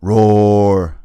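loud trumpeting roar; a big, loud animal roar; elephant roar. Acted.

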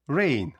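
«Rain» slowly, with a long «ei»; long vowel. Shown as a demonstration. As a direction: stretch «Rain» as «rein». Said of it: "перша літера вимовляється як довгий звук". («Rain» з довгим звуком «ей»)

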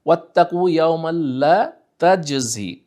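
slow chanted recitation, a touch melodic; recited verse fragment. Shown as a demonstration. Teacher repeating a verse phrase, lightly chanted. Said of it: "তাহলে এখানে আমরা দেখছি".

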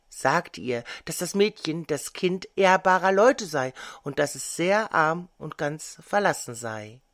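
ordinary delivery, nothing marked

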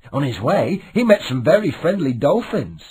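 ordinary delivery, nothing marked

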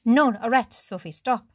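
sudden loud warning; urgent stop. A narrator's reading. Matteo halting Sophie at the crumbling roof, loud and urgent. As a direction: stop her before she jumps. Matteo stops her jumping.

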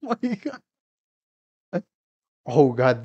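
ordinary delivery

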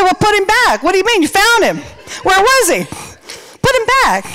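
high-pitched incredulous protest; mock alarm. Friend protesting the newly found dude, high mock alarm. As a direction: object in high, exaggerated disbelief.

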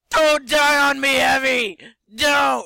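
loud desperate high cry; panicked shout. Real, not acted. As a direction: yell in panic for him to live. Panicked shout at dying patient.

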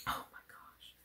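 soft whispered gasp of wonder; awed whisper. Real, not acted. Opening Jude's gift, whispered awe. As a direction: barely breathe it out.

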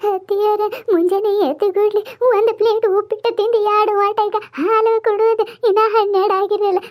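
keen loudly like a hired mourner; loud mock wailing. Mourners wail loudly at the body.

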